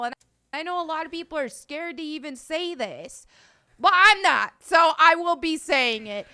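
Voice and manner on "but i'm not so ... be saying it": loud and unafraid; defiant, louder. Real, not acted. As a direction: raise the voice, defiant. Defiant declaration to critics.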